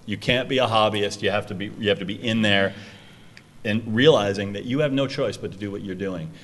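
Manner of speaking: ordinary talk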